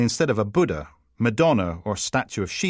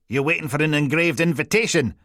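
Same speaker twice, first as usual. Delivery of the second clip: impatient sarcasm; 6 semitones higher, 3 dB louder than usual. A narrator's reading. Boss chivvies a dawdling officer.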